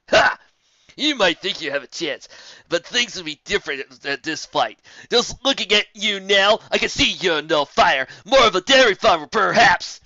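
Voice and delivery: loud, slow jeering griffin; loud braggart mockery; mocking taunt. A narrator's reading. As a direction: jeer for the crowd's laughs. Geller mocks Rarity.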